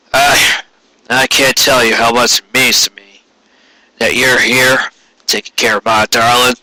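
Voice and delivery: halting old stallion, lightly voiced, pushing a rumble to hide frailty; a hint of an old stallion's forced rumble, grateful and halting. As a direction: stammer the thanks, forcing a rumble over a failing voice. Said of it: "forcing his trademark rumble into his voice".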